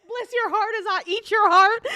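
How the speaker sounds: high, sugary threat through a laugh; sweet monster, laughing. Pretend. Host plays the sweet monster vowing to eat a heart, high and giggly. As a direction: sweetly promise to eat them. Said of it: "just sweet voice?".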